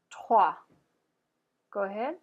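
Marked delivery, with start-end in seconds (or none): 0.1-0.5 loud "Trois" as "trwah", R from the throat; French "Trois", strong guttural R after the T. Shown as a demonstration. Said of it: "pronouncing that guttural R".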